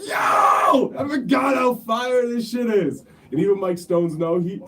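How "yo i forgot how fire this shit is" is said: loud, high hyped exclamation; excited shout. Retold or imitated. Retold excited reaction.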